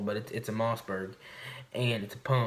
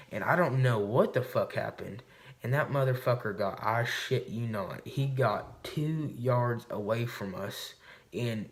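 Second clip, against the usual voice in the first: ordinary talk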